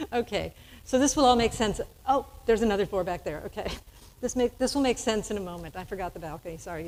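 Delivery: usual speaking voice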